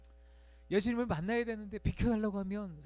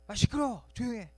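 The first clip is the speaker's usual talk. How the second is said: annoyed crowd; 5 semitones higher, 6 dB louder than usual. Retold or imitated. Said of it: "주변 사람들의 어떤 반응이었죠".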